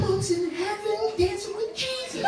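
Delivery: put-on very high, soft, stretched-out child voice; excited kid voice. Boy telling his younger brother, high excited voice. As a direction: imitate an amazed child passing on news.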